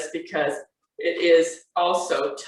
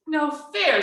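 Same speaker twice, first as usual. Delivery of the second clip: high little cub's objection; protesting Baby Bear; 9 semitones higher than usual. A narrator's reading. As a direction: object like a small child, pitched high.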